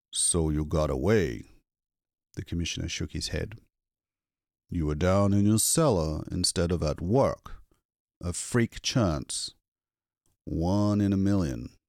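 a hint of a gruff, measured official; the commissioner explains Lois's lucky escape, gruff; lightly voiced commissioner.

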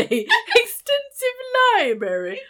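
mock British pronunciation; British accent. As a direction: imitate a Brit, drawing out the vowels. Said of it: "never heard an American say".

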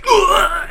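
high, loud choking gasp; orc death gasp. Retold or imitated. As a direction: choke out a dying gasp. Orcs suffocate in space.

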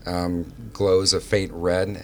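ordinary talk

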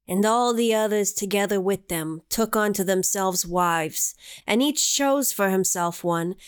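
slow, grave biblical recitation; solemn scripture reading. Acted. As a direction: intone it like ancient scripture. Reads scripture aloud.

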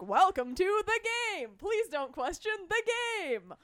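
original game show host take; host's welcome, straight and presentational. Shown as a demonstration. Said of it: "The first things you were gonna hear".

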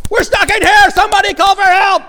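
loud, high panicked yell through the door; shouting for help. Retold or imitated. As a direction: bang on the door and yell for someone to come. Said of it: "banged on the door and said".

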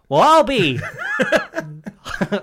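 slow, high, hammed-up drawl; silly yokel exclaim. Friend jokes around with a slow, high hammed-up exclamation.

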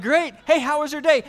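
mock-eager, cutting the history lesson short; eager dad. Dad to son at bedtime, mock-eager and loud.

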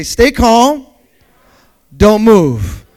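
shouted call to repeat; congregation call. Pretend. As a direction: belt it out for the church to echo. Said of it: "Somebody say".

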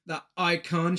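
ordinary talk, nothing marked

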